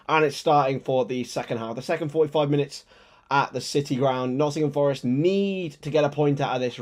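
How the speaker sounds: lively match commentary with a mild broadcaster lift; play-by-play commentary. Acted. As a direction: call the action like a TV commentator.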